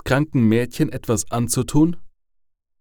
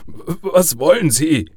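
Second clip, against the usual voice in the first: higher, stammered shaky question; 6 semitones higher, 4 dB louder than usual. A narrator's reading. Father asks the threatening caller, shaky. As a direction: stammer the first sound, nervous and trembling. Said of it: "fragte Harold zittrig".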